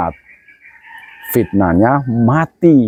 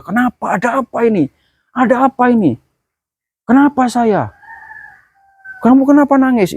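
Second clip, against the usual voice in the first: high, frantic bewildered cries; panicked soul; 11 semitones higher than usual. Acted. Preacher voicing a dead man seeing his family weep, high frantic cries. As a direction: cry out lost and terrified.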